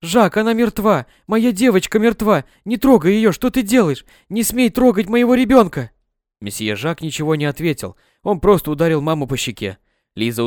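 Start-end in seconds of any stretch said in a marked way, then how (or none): 0.0-5.9 истошный крик, выше и громче; не даёт Жаку тронуть ребёнка, крича; кричит в голос. Acted. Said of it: "мама кричала в голос". (мать кричит на Жака, выше и громче)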